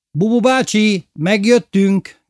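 loud, high call into the house. A narrator's reading. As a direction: shout it into the empty house. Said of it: "kiáltottam be a házba". (hangos kiáltás)

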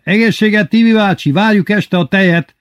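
high, loud shouted reply; calling out. A narrator's reading. Lili asking Tibi bácsi for milk, calling out. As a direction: call out to someone passing in the street. Lili calls to the passing cart.

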